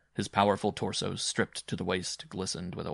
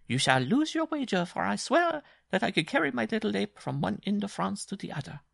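high-pitched, vowing bride voice; boastful bride; 13 semitones higher than usual. A narrator's reading. Bride accepting Simon's wager, high and boastful.

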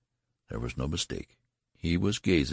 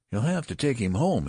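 insistent; 8 semitones higher, 4 dB louder than usual. Acted. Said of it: "insisted the nurse".

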